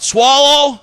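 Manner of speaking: a high yelled heckle from the crowd; heckler yell. Retold or imitated. Heckler at a small Wednesday show yelling, high shout. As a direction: call it out high like a drunk heckler. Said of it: "Guy yelled out".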